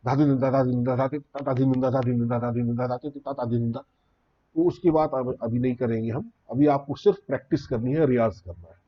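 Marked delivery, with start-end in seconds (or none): 0.1-3.8 bols run together at top speed; very fast bols. Shown as a demonstration. Teacher shows how experts play very fast. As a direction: fire them off rapidly. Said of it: "बजाने वाले तो बहुत तेज भी बजाते हैं".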